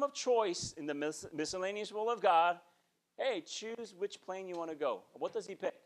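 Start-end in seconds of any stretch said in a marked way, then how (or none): none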